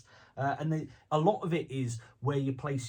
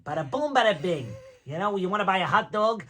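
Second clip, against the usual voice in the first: louder New York Joe Pesci voice; 4 semitones higher, 6 dB louder than usual. Shown as a demonstration. A Joe Pesci New York voice shown to the interviewer. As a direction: punch it out like a New York wiseguy. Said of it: "a Joe Pesci".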